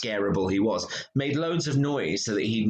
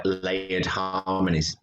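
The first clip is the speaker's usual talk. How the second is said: usual speaking voice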